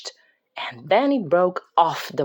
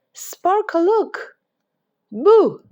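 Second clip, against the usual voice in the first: put-on high girl voice, loud on the Boo; little girl shouting Boo to scare; playful boo; 12 semitones higher, 7 dB louder, slower than usual. A narrator's reading. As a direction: jump out and scare a friend. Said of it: "Sparkle was terrified".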